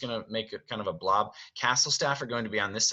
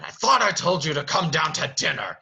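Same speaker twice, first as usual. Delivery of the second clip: louder, raised angry demand; almost scream the order; Beast yelling; 6 semitones higher, 5 dB louder than usual. Acted. Master yells at Belle through the door, angry. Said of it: "he yells, screams at her almost".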